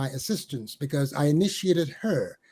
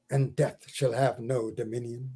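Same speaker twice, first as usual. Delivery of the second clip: softer, lower refrain that sinks away; soft stanza close; 4 semitones lower, 6 dB quieter than usual. Acted. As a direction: let the line die down gently. Quiet close of poem stanza.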